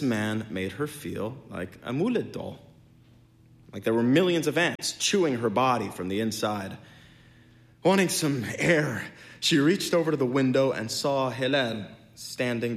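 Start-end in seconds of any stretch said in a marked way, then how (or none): none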